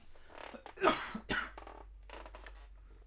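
rough real coughing bout; coughing fit. Real, not acted. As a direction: hack out a long cough. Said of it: "excuse me".

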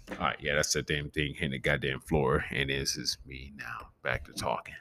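ordinary talk

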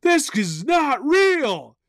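high, loud drawn-out exclamation; reliving a shock, big and high-pitched; shocked dream outburst. Retold or imitated. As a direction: cry it out slowly in mock astonishment. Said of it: "And I was like".

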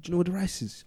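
whispering to his mate's mum; hushed, breathy ask so no one hears. Retold or imitated. Whispering to Auntie about the rice. Quietly asking friend's mum.

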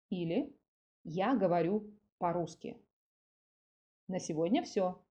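usual speaking voice

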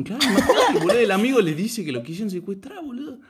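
very high, laughing while telling it. Real, not acted. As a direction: crack up mid-story, loud and high. Narrator laughing at story. (riendo a carcajadas, muy agudo)

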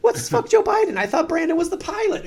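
very high, loud, baffled questions; mock loud bewilderment. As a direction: cry out in total confusion. Baffled passengers yelling, retold.

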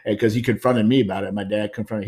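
ordinary delivery

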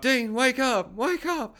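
high, teasing rousing call; singsong wake-up call. Acted. Villains rousing knocked-out Dean, high singsong call.

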